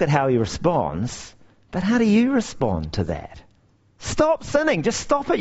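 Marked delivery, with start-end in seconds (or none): 4.0-4.8 high, quick nagging command; preacher mocking a nag; nagging stop sinning. Mocking how we hear the command. 4.8-5.4 high, rising scold; preacher mock-scolding his listeners.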